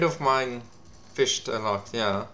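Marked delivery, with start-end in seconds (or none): none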